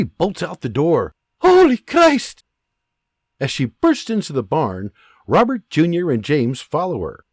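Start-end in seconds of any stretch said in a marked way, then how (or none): 1.4-2.4 high, loud, slow gasp of fear; a terrified oath as she runs; mother running out, crying out in fright; frightened exclamation. Acted.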